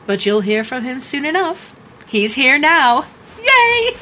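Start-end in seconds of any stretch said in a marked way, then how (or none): 3.4-4.0 high, loud little squeal; girlish burst of glee; gleeful cheer. Acted. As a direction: cheer brightly, breaking into a giggle. Mock cheer at father's return.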